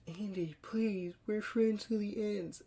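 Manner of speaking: pleading Chucky. Retold or imitated. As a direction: beg your friend as a melting doll. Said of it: "and Chucky goes".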